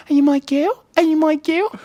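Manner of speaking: put-on high, loud baby talk to a pet; dog-talk voice. Shown as a demonstration. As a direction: squeal it at your dog. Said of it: "I have that dog voice too".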